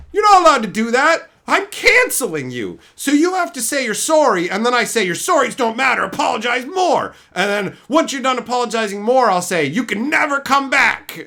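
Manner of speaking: high, loud mocking whine of an outraged tweeter; mock the shrill cancellers; whiny mock critic.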